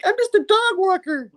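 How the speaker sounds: very high falsetto protest; pleading woman. Acted. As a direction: squeal innocence in mock distress. Woman protests innocence to cops.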